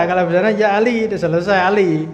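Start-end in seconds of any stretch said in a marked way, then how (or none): none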